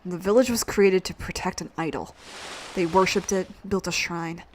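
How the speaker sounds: low, quiet, grudging hero voice; sullen reluctant hero. Acted. Ryudo tells of his village.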